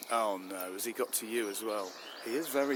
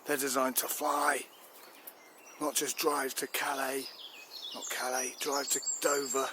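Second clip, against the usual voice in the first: indignant rant; 3 semitones higher than usual. Acted. Chief fumes to the minister over wasted fighters, indignant. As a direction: vent about wasted billions.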